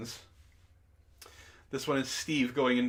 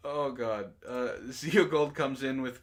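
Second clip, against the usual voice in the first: usual speaking voice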